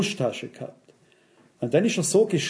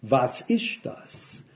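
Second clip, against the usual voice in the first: low, slow, curt question; a low, slow challenge; sharp demand; 5 semitones lower, 6 dB quieter, much slower than usual. Retold or imitated. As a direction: slap it down and demand an answer. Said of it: "knallt es mir vor die Nase hin und sagt".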